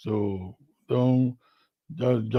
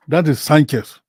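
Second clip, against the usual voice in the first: louder, punching the name Sanchez; emphatic repeat; 4 semitones higher, 8 dB louder than usual. Real, not acted. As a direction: hammer home "That is Sanchez".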